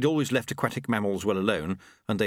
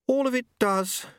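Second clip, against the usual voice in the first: high, prim and polite; a higher, refined lady's voice; Lady Lejeune voice; 3 dB louder than usual. A narrator's reading. Lady Lejeune politely answering Susan, in a higher refined voice.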